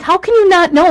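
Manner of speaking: high, loud mock disbelief; incredulous retort. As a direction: act out an exasperated husband. Acted husband's disbelieving retort.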